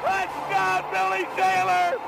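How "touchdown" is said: ordinary talk, nothing marked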